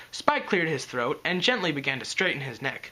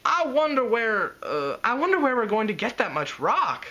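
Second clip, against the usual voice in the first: pondering aloud; 8 semitones higher, 4 dB louder than usual. Acted. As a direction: think it through out loud, a little puzzled. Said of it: "Twilight pondered".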